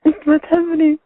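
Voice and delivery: upset pleading. Real, not acted. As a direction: demand an answer, wounded. (عتاب مجروح بصوت عالٍ)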